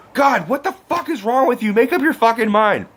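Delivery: high-pitched, exasperated berating; imitated angry outburst. Boy berating the girl who pushed him away, exasperated. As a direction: act out a fed-up boy losing patience.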